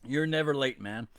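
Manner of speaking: usual speaking voice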